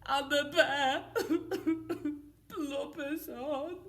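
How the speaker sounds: loud high hammed-up crying; mock blubbering. Teacher acting bear crying, hammed-up sobs. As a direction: wail it like a bear in tears. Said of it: "he whimpers and he moans".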